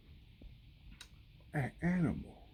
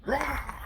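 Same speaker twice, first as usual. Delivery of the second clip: high, loud playful rawr; mock roar; 10 semitones higher, 7 dB louder than usual. The alligator roaring in frustration at the zebra. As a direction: roar like a cartoon croc.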